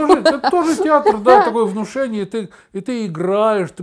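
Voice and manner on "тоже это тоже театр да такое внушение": high laughing voice, louder; laughing through the words. Real, not acted. Laughing at partner's remark. (смеясь, высоким голосом)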